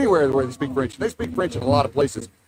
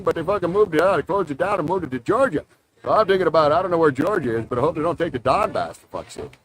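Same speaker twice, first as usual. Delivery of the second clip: put-on Newfoundland accent; 2 semitones higher than usual. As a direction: lay on a thick Maritime Newfoundland twang. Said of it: "It's a unique Canadian accent".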